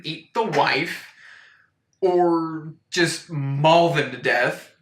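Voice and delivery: ordinary talk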